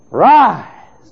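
sudden loud, high call to rise; booming divine command; heavenly command. Acted. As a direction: ring out like God raising the dead. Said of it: "a voice from heaven saying".

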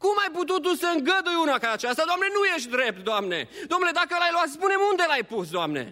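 high, fast, reproachful complaint; blaming God. Acted. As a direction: accuse God in quick protest. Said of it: "dăm vina pe El".

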